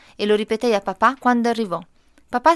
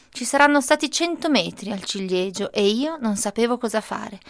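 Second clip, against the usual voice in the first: plain reading voice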